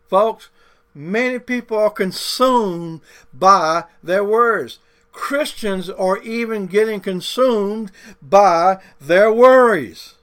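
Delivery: loud, rising preaching build to a cry on worries; full-force preaching. Real, not acted. Preacher warning the congregation, building to a loud cry. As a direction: drive the warning home to the church.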